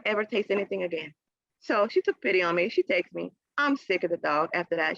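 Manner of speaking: normal talking voice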